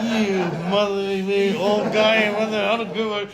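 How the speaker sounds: slow, loud indignant sputter; mock angry outburst. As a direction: sputter at him in fake offence. Angry reaction retold.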